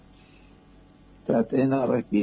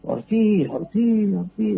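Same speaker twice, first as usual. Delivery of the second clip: retold yelling; 7 semitones higher, slower than usual. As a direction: shout for a buried comrade. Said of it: "le grité".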